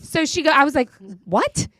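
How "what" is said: retold disbelief; stunned what. Telling the voter her reaction, a mild stunned what. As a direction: ask it in disbelief. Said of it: "that's how I responded".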